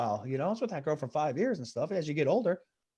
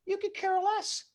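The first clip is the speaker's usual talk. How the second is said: high squeaky voice; 12 semitones higher than usual. Acted. Comic high-pitched punchline.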